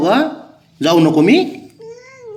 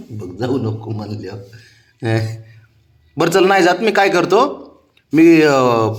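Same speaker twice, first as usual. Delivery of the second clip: higher, sing-song coaxing of a sulking pet; 4 semitones higher, 7 dB louder than usual. Real, not acted. Owner coaxing his sulking cat Babya before work, sing-song coaxing. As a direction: sweet-talk the cat so it lets you leave.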